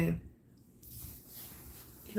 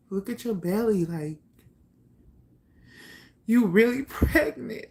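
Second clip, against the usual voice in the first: mock disbelief; 4 semitones higher, 5 dB louder than usual. Brother's girlfriend confronting pregnant teen.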